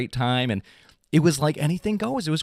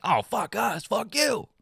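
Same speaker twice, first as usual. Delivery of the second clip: mock crowd; 8 semitones higher, 4 dB quieter, slower than usual. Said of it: "they're gonna be like".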